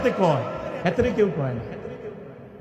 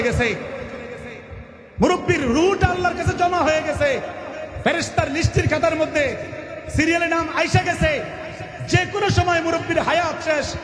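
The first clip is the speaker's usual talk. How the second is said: raised, driving sermon cadence; full-force preaching; 5 semitones higher than usual. Acted. Preacher thundering at careless old men, full-force sermon. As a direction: preach it hard, rising line after line.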